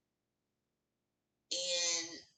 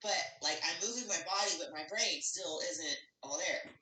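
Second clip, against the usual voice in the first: ordinary delivery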